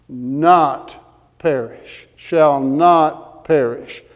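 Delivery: very slow, "not" stretched out and set apart from "perish"; emphatic NOT. Real, not acted. Preacher hammers the verse's promise to congregation, slow emphatic "not". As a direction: dwell heavily on each "not", pausing before "perish".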